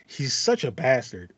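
ordinary talk

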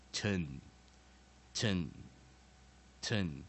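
"ten" said as "tuhn", slowly, with pauses; weak vowel schwa. Shown as a demonstration. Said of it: "就念呃， 所以念ten".

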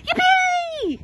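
very high, gleeful cheer; high, drawn-out whoop of joy; excited shout. A narrator's reading. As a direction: shriek it like a delighted child. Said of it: "shouted Billy".

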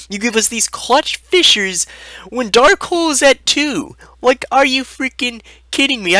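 high-pitched incredulous rant; worked-up, disbelieving complaint; exasperated outrage. Acted. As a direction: protest it high, stretching the first words. Ranting at a deck listing.

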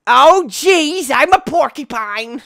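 high, loud, odd voice squeak; sounding like Neil. Pretend. Gamer's avatar turns porcupine, high odd yelp. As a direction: yelp in a strange put-on voice. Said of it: "I sound like Neil".

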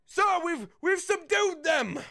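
high, loud victory announcement; distant character calling out. Acted. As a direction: call it out from across the yard in a put-on voice. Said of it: "a, a different voice".

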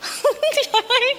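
high, rising giggle; laugh. Acted. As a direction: burst into high-pitched laughter. Laughing at the name Long. (cười ré lên)

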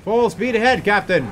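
high, loud call to charge; a pirate's battle cry; hearty rally cry. Acted. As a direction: shout it out to rouse the crew. Rallying the crew in game.

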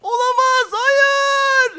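high-pitched horrified cry; high, drawn-out dismay; panicked. Acted. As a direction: wail in cartoon panic. Caught, Tarık comes after him.

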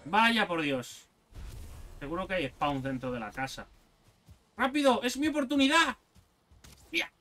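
normal talking voice

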